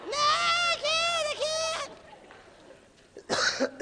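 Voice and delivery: acted high, drawn-out panicky wail; panicked inner voice. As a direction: wail it in mock panic, stretching the words. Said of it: "in your mind going".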